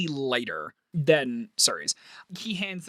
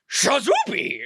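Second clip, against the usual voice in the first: magic word; 9 semitones higher than usual. Acted. Wizard casts on final teapot.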